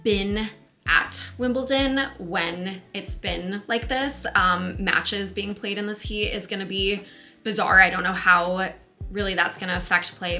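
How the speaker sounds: ordinary talk, nothing marked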